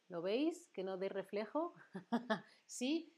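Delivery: ordinary talk, nothing marked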